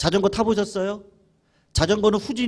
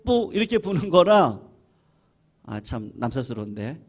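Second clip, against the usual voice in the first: usual speaking voice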